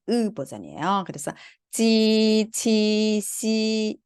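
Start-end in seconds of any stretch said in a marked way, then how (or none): 1.7-4.0 찌, 치, 시 each held long on "ee"; i version. Shown as a demonstration. Teacher contrasts two vowel versions, long "ee" in 찌, 치, 시. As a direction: stretch 찌, 치, 시 with a clear 이 vowel. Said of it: "요게는 이 버전".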